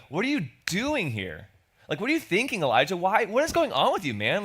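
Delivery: incredulous, scolding God voice, rising high; exasperated rebuke. Shown as a demonstration. Preacher voicing God as an exasperated scold. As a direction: scold him like a fed-up boss. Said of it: "I always read in the voice I would expect to hear".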